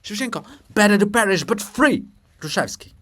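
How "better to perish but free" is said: loud high defiance; defiant cry. Acted. Shevchenko defies Hrushevsky with a loud proud cry. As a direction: declare it boldly.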